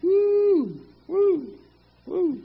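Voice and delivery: high joyful woo; excited whoop. Real, not acted. Preacher whooping after the verse, high and excited. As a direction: let out a happy whoop.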